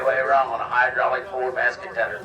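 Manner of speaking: ordinary delivery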